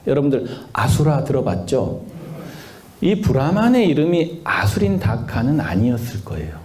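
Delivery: normal talking voice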